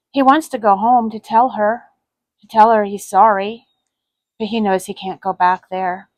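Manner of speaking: ordinary delivery, nothing marked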